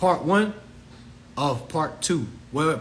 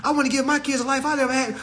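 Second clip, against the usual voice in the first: mocking parents; 9 semitones higher, faster than usual. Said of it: "Folks be like".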